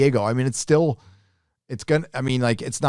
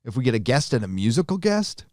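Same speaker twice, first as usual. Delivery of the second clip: ordinary talk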